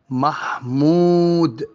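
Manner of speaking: محمود with a long held "oo" before the d; drawn-out vowel. Shown as a demonstration. Teacher showing learners a long vowel, محمود drawn out. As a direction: stretch the و in محمود very slowly. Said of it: "يبقى أنا مديت في الواو".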